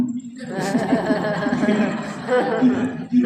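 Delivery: long amused laugh; real laugh. Real, not acted. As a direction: laugh out at the punchline. Laughs at own story. (tertawa lepas)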